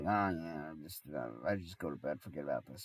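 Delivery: low, soft, weary muttering; acted sick resignation, low and quiet; resigned mumble. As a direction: grumble it quietly to yourself. Sick, retelling giving up.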